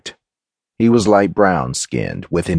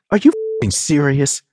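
high, incredulous sarcastic question; sarcasm, pitched up; dripping sarcasm; 8 semitones higher than usual. A narrator's reading. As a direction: ask it with scornful disbelief. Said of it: "her tone dripping with sarcasm".

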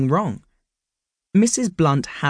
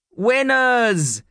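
shouted; 8 semitones higher than usual. A narrator's reading. The team cheering for Mister Hyde, now shouting. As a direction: belt it out as a cheer. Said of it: "they all shouted".

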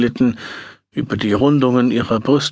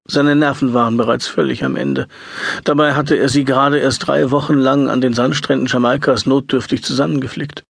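ordinary delivery

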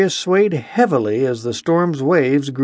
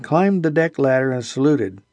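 ordinary delivery, nothing marked

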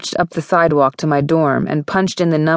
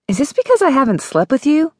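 high-pitched disbelieving question; affronted, high question; incredulous accusation; 10 semitones higher, faster than usual. A narrator's reading. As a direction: challenge him, voice jumping up high. Heroine challenges man dumping her.

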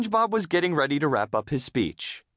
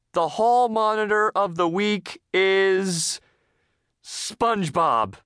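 higher, very slow, drawn-out announcement; reluctant announcement; 8 semitones higher, 3 dB louder, slower than usual. A narrator's reading. Missus Puff announcing the dreaded winner, reluctantly. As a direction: drag it out before the dreaded name.

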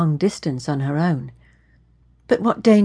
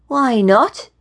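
higher-pitched protest, rising at the end; whiny question; 6 semitones higher, 3 dB louder than usual. A narrator's reading. Young man refused by the woman, whiny protest.

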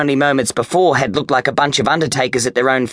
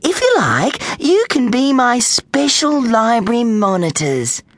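high, delighted librarian voice; gushing; 9 semitones higher than usual. A narrator's reading. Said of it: "she gushed".

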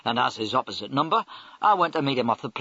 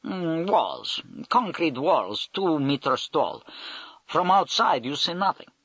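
put-on Russian accent; 5 semitones higher, slower than usual. Russian major describes his base.

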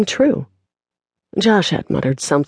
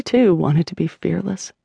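usual speaking voice

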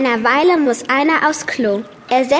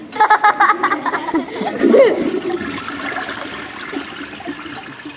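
long airy laughter; breathy laugh; 7 semitones higher than usual. Real, not acted. Laugh after toilet joke.

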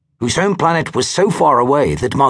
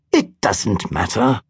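slow, lower, shrugging calm; letting it go easily; unbothered acceptance; philosophical; 5 semitones lower, slower than usual. A narrator's reading. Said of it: "replied Christille philosophically".

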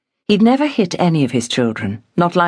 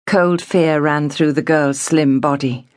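ordinary delivery

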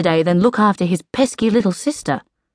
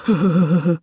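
short dopey chuckle; brief, even huh-huh laugh. A narrator's reading. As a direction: chuckle lazily in a short run. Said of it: "chuckled Dude".

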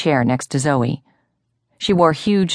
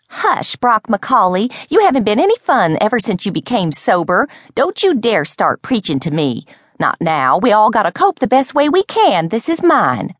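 high-pitched, dramatic tipsy rebuke; indignant scolding; 8 semitones higher, 3 dB louder than usual. A narrator's reading. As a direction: tell off a killjoy in a high, worked-up voice. Tipsy woman scolds sober friend.

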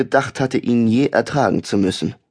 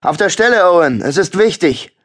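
loud, higher pompous summons; overeager bossy call; 7 semitones higher, 5 dB louder than usual. A narrator's reading. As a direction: call him in like a puffed-up boss. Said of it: "klang so übereifrig und aufgeblasen".

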